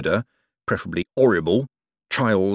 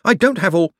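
higher, louder protest cut short; breathless protest; 10 semitones higher, 6 dB louder than usual. A narrator's reading. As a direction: protest urgently until interrupted. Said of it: "panting for breath".

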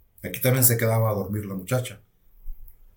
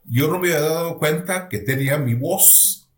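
normal talking voice

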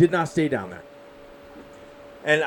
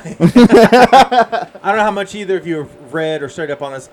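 laughing; 3 semitones higher, 10 dB louder than usual. Real, not acted. Laughing through the question.

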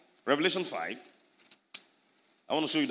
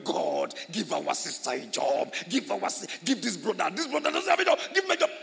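mock prayer; 4 semitones higher, 4 dB louder than usual. Preacher acting church members praying for a brother's job, pleading. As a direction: beg God for a job, climbing.

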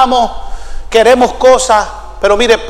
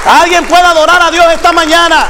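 raised-pitch, driving sermon cry; full-force preaching; 4 semitones higher than usual. Real, not acted. Preacher rallies the congregation to keep working, full-force preaching. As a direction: preach it out with ringing, insistent repetition.

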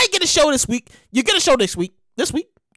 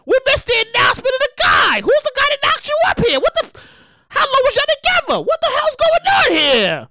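exasperated fan; 11 semitones higher than usual. Retold or imitated. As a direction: demand answers, mock-indignant. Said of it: "And I'll be sitting there like".

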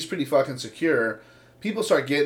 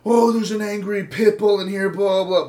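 mocking shout; 6 semitones higher, 5 dB louder than usual. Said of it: "shouting about".

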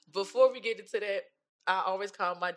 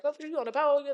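high-pitched surprised question; shocked grandmother; 10 semitones higher, 4 dB louder, faster than usual. Retold or imitated. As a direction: imitate a grandmother caught by surprise. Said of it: "she was shocked".

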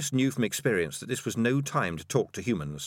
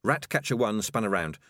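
ordinary delivery

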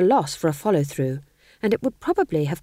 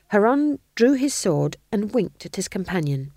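ordinary delivery

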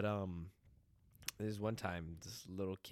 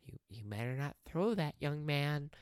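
high, lightly voiced elderly lady; a frail old lady scolding; elderly bus monitor scolding a boy; old woman warning; 7 semitones higher, 3 dB louder than usual. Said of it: "poor elderly woman ... was like".

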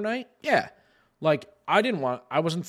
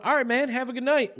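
huffy sign-off; 9 semitones higher than usual. Retold or imitated. Retold huffy exit from Discord.

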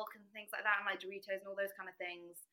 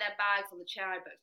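normal talking voice

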